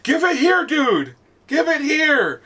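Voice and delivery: high, insistent mock demand; whine-yell like a kid wanting it back; imitated demanding kid. Kid demands his power-up from teammate, imitated insistence.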